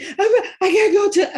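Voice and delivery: high, loud sobbing words; imitating crying child. Mother imitates daughter crying she has to go to the office, high and loud. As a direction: stammer it through tears.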